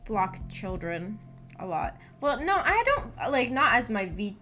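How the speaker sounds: normal talking voice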